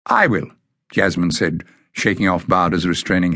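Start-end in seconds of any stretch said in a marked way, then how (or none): 0.1-0.5 bold. A narrator's reading. Jasmine steps forward.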